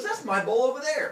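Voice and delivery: comic indignant exclamation; accusing. Retold or imitated. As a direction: call out the hidden bull. Poseidon spots the real bull.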